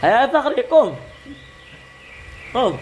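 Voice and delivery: high "Ayah Fakhri" call. Shown as a demonstration.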